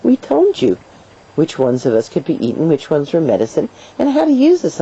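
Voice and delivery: high, exaggerated scoffing; mock exasperation. Plants scolding humans for doubting them, mock exasperation. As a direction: scoff as if it's painfully obvious, pitch leaping up.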